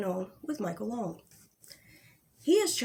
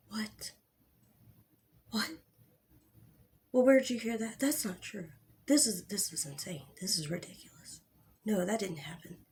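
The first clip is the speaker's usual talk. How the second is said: Michael Long face voice. Retold or imitated. Vlogger mimicking Michael Long denying claims in his live, mild mock innocence. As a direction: lightly imitate a man feigning disbelief. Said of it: "let me do it in a Michael va-, face voice".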